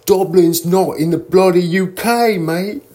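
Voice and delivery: high, loud mock British accent. An imagined Irish local corrects the tour site, in a mock British accent. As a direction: toss off a bloody and a mate like a Brit. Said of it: "'Cause they would say".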